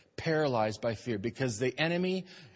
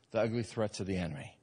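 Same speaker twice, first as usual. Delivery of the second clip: usual speaking voice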